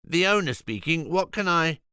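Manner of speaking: a harried vegan owner answering; Julian's voice. Acted. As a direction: rattle off the shop name. Julian's character voice.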